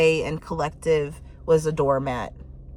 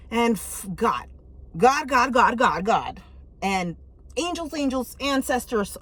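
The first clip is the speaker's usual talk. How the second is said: mock panic; 5 semitones higher, 3 dB louder than usual. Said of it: "When you finally just like".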